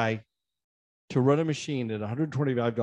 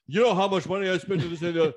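lightly mocked entitled customer, quick; hint of a pompous big spender; soapbox complaint; 6 semitones higher, faster than usual. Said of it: "standing on a soapbox going".

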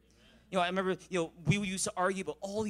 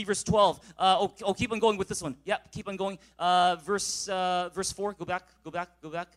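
ordinary talk, nothing marked

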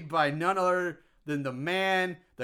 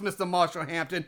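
usual speaking voice

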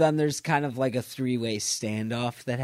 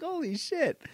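amazed; 13 semitones higher, 5 dB quieter than usual. Retold or imitated. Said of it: "I was like".

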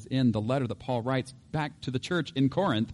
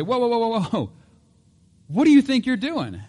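high, loud, frantic protest; indignant, high-pitched hollering; alarmed outcry; 12 semitones higher, 7 dB louder than usual. Acted. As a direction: shout after someone who is getting away. Restaurant crowd chases gun-firing panda.

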